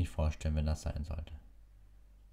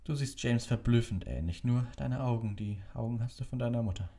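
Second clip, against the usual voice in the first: put-on gentle old wizard; 8 semitones higher than usual. A narrator's reading. Dumbledore voiced in story.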